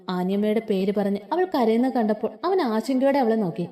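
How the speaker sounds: ordinary delivery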